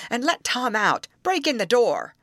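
high, urgent orders; directing. A narrator's reading. Rescue leader ordering his men to free the prisoner, directing. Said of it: "directed Mister Hammond to his men".

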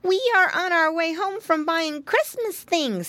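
put-on high-pitched child voice; piggy boy. A narrator's reading. Piglet explaining to Santa, high little voice. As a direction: answer Santa in an eager piglet squeak. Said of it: "said Flop Ear".

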